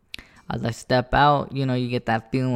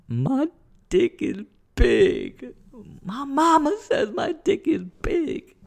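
much higher, whiny fake-crying kid voice; mock crying child; 13 semitones higher, 4 dB louder, slower than usual. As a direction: blubber it like a bratty little kid. Childish retort to laughing family.

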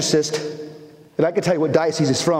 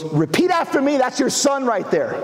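higher-pitched, insistent order; urgent command; 8 semitones higher than usual. Retold or imitated. Team leader pushing the father to pray, urgent and insistent. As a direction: imitate a leader pressing a reluctant man.

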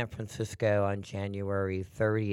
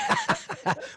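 bright laugh. Real, not acted. Laughing after a dropped call.

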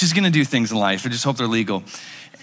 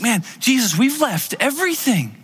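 higher, eager plea to Jesus; pleading disciples; 6 semitones higher than usual. Retold or imitated. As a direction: push it like you can't hold back. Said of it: "It's like".

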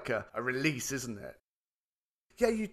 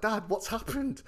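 higher, urgent question; worried son; 9 semitones higher than usual. Retold or imitated. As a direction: ask the father anxiously. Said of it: "I was like".

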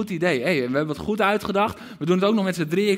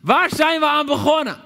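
much higher, louder outcry; "Waar zijn we aan begonnen?" yelled high and loud for all to hear; cry out; 12 semitones higher, 8 dB louder than usual. Shown as a demonstration. Preacher showing the king crying out to soldiers, loud outcry. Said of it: "dat hij het uitroept".

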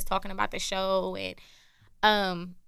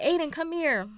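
put-on higher-pitched summons to a child; retold calling over; 8 semitones higher than usual. Retelling calling a little boy over from the phone, summoning. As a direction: call the kid over, pitched up.

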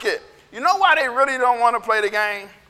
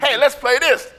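punchy, slightly lifted call; rally a playmate; lively mock-child suggestion; eager kid invitation; 2 semitones higher than usual. Imitating kid proposing a game.

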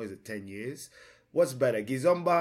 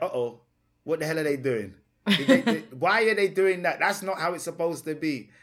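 mock outrage; 5 semitones higher, 7 dB louder than usual. Scene reacting to fusion.

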